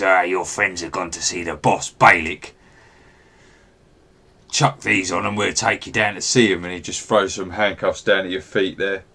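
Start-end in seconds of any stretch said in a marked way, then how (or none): 0.0-6.6 lightly voiced goblin corporal; a hint of an assertive minion giving orders; self-important goblin. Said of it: "fancies himself as a corporal".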